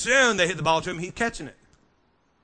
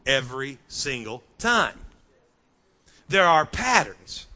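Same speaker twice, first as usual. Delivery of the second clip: usual speaking voice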